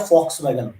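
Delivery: Volkswagen begun with an F sound; patented-name Volkswagen, opening on F; F pronunciation. Shown as a demonstration. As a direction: say Volkswagen as "FOKS-vagen". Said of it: "उसका एक नाम patent किया".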